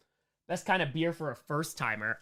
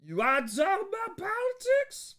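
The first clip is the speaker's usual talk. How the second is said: mock host voice; 14 semitones higher, slower than usual. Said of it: "and be like".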